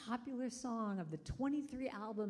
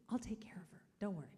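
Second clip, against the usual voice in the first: whisper; 5 dB quieter, faster than usual. Retold or imitated. Whispering to boy at airport.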